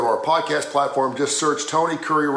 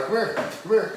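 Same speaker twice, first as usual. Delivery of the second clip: high sing-song call; calling the dog; 7 semitones higher than usual. Real, not acted. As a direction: coax the dog over.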